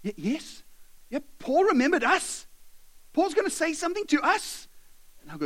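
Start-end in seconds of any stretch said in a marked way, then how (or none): 0.0-0.6 quiet, tentative eager yes; acted hopeful listener; ears pricking up. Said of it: "your ears prick up and you're like". 1.1-4.7 high-pitched thrilled exclamation; acted wide-eyed delight; excited. Slaves thrilled to be addressed.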